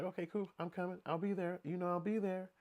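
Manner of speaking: high, soft eager voice; mock the young woman agreeing sweetly; high soft mock girl voice; imitating her. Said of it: "And she like".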